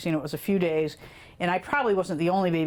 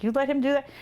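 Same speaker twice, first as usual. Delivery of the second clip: retold disbelief; 7 semitones higher, faster than usual. Babysitter questioning an indulgent mother.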